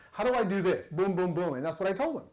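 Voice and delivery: ordinary talk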